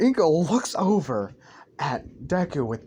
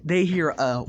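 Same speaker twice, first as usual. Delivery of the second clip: ordinary delivery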